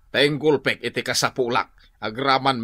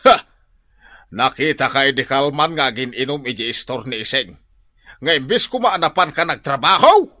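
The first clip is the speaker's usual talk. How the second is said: normal talking voice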